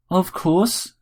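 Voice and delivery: high-pitched wifely robot voice; accented feminine robot. A narrator's reading. Bot confirms to Harry it is Helen, indignant. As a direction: answer as the indignant wife in the robot.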